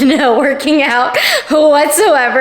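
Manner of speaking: laughing through the words; giggling while speaking. Real, not acted. Laughing at herself.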